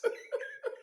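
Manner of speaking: high whispered burst of laughter; breathless laugh. Real, not acted. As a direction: laugh with no voice left.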